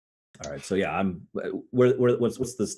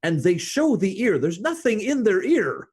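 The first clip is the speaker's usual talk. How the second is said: pitched up, hammed explanation; excited, higher insistence; 12 semitones higher than usual. Real, not acted. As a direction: player defends the MCU trick, excited and higher. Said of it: "they just do this".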